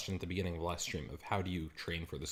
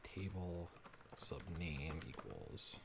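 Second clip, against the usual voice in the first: muttering to himself; 3 semitones lower, 14 dB quieter, slower than usual. Real, not acted. Muttering code while typing.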